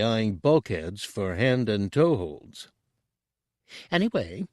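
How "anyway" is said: high, hurried little voice; quick exclamation. A narrator's reading. Tooty breaking the tension, high and hurried. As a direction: jump in quickly to change the subject. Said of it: "he exclaimed quickly".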